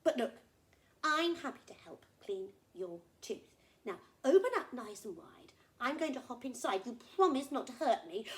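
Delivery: loud, brisk little bird taking charge; helpful little bird. Acted. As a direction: offer help but ask for a promise. Bird offers to help.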